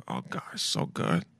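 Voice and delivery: breathy whispered pleasure, very soft; whispered moan. Retold or imitated. Guy retelling his first sip, whispered very softly. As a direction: whisper it like a secret bite. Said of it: "That was me".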